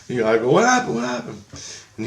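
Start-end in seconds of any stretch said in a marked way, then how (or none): 0.5-1.4 high-pitched, urgent retold question; excited questioning. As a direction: play up an eager listener demanding the news. Excited reply to friend's urgent news.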